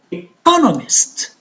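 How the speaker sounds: "economist" with CO pitched high, then falling; stress on CO. Shown as a demonstration. Teacher showing stress shift, "economist" stressed on CO. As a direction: punch the second syllable of "economist", e-CO-nomist. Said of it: "you see the stress change".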